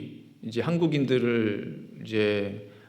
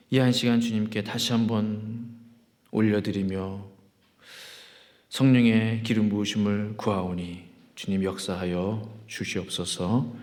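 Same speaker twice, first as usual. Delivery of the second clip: low, level praying voice; solemn prayer; 4 semitones lower than usual. Real, not acted. Pastor praying to God before the sermon, solemn prayer. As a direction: pray on an even, lowered note.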